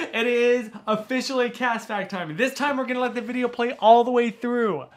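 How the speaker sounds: loud high hype; excited announcement. Real, not acted. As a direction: announce it like a game show. Announcing cast fact time excitedly.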